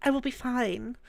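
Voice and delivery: put-on high, British-accented character voice. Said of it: "add a British accent to it".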